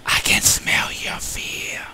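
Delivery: slow ghostly whisper; scary whisper. Pretend. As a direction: breathe it like a haunting ghost. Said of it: "It's really a scary voice".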